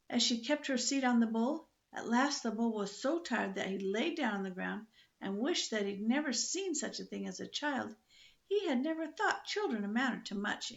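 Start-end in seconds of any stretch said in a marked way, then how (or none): none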